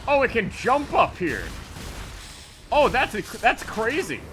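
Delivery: high excited shout of surprise; surprised. Real, not acted. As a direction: blurt it out, genuinely shocked. Boss surprises him mid-fight.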